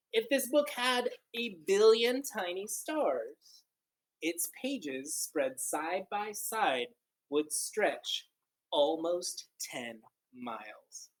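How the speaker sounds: slow, lilting picture-book reading; read-aloud storytelling. Acted. Teacher reading a counting book to children, lilting and slow. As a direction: read it like a wondrous tale to kids.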